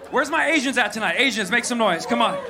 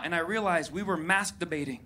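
ordinary talk, nothing marked

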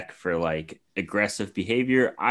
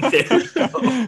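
excited cheer; 5 dB louder, slower than usual. Real, not acted. Cheering a point.

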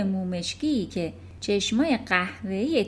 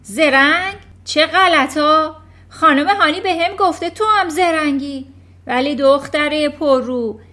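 much louder, higher scornful yelling; shouting; 6 semitones higher, 10 dB louder than usual. Acted. Headmistress yelling that clever people are crooks. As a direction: spit out the scorn at full volume. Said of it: "ترانچبول فریاد زد".

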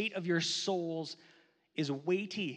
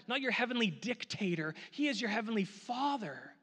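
normal talking voice

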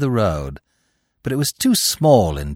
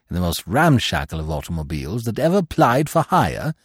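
ordinary talk, nothing marked

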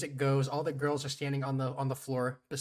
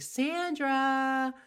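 mock call out; 10 semitones higher, 3 dB louder than usual. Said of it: "She's like".

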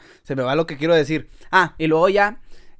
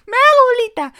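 much higher, louder mock squeal; squeaky reply; 9 dB louder than usual. Narrator mocking himself agreeing to curl up, high squeaky voice. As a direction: squeak it like a scared little kid.

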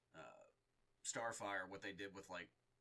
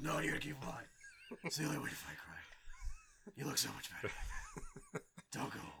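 creepy Batman voice; 6 semitones higher than usual. Retold or imitated. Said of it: "He's like".

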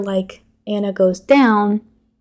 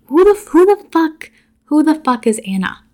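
incredulous outburst; 7 semitones higher, 4 dB louder than usual. Real, not acted. Exasperated about mystery character.

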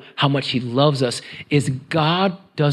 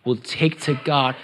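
ordinary delivery

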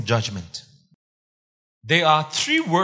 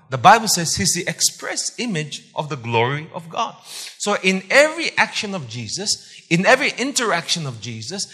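ordinary delivery

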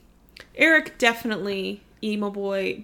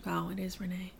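whispered; 3 semitones lower, 10 dB quieter than usual. Real, not acted. Realizing a name.

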